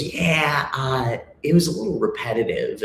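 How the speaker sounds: mock the teacher's lazy verdict; imitating teacher. Retelling songwriting teacher's critique, lightly mocking. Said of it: "he goes".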